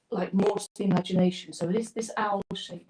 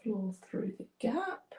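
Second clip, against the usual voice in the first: whispering; 4 semitones higher, 8 dB quieter, slower than usual. Real, not acted. Concentrating, painting detail.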